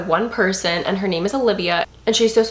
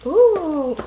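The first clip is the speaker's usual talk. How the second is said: high, excited oohing; thrilled, voice shooting up high; delighted surprise; 4 dB louder, slower than usual. Real, not acted. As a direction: coo with delight at a sweet find. Finds a handwritten note, thrilled.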